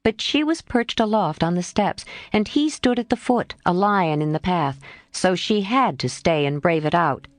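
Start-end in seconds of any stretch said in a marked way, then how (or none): none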